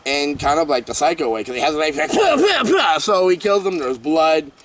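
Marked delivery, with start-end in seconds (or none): 2.1-3.0 high mock stab noises; stabbing sound. Vlogger imitating killer's knife stabs, sharp high noises. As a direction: spit out stab sounds like a slasher score.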